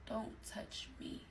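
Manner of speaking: very soft, slow whisper; whispered warning. Retold or imitated. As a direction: whisper it slowly, spacing out each word. Repeats rebuff while turning away in bed.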